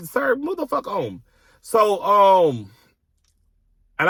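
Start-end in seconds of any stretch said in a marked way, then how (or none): 0.1-1.2 higher retold dismissal. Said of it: "girl, like".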